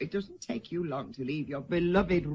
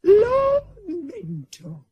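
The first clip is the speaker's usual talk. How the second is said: high, loud, slow drawn-out surprise; surprised exclamation; 10 semitones higher, 9 dB louder, much slower than usual. Acted. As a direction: caught off guard by the name. Surprise at the visitor's card.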